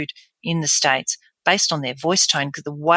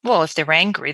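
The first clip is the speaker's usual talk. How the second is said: ordinary talk, nothing marked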